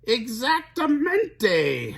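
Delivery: long, high, loud doggy howl. A narrator's reading. Doggies answering their friend, howling. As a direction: howl the word slowly like a pack of dogs. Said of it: "howled lo-, the doggies".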